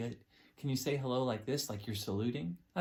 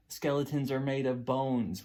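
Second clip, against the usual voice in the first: usual speaking voice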